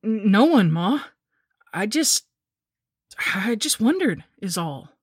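frightened, halting excuse; fearful stammer. A narrator's reading. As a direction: stammer nervously, backing off the question. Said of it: "shook my head in fear".

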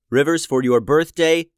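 usual speaking voice

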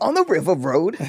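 hammed-up British accent, close to laughing. Podcaster playing Idris boasting of a gig, mock British accent. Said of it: "How you do a British accent".

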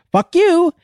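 very high parrot squawk. Acted. Parrot cursing at the passing customer, very high squawky voice. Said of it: "the parrot goes".

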